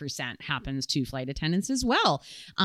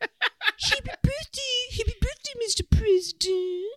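Marilyn Monroe impression. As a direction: sing it like a sultry film star. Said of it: "a Marilyn Monroe impression".

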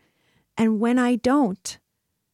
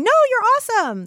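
much higher and louder, mock over-the-top cheering; gushing self-esteem praise; 13 semitones higher, 5 dB louder than usual. Self-esteem movement parent praising a kid, exaggerated mock gushing. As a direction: ham up a parent who praises everything. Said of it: "their parent was saying".

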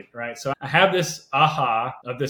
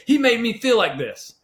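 pitched high, louder, agitated retelling; upset complaint; 9 semitones higher, 5 dB louder than usual. Retold or imitated. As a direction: vent it like you're still worked up. Retelling blowup to counselor.

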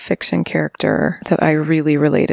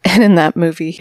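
normal talking voice

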